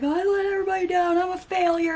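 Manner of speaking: mock a kid wailing that he failed; whiny self-pity. Imitating son's self-pity.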